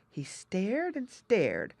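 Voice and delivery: plain reading voice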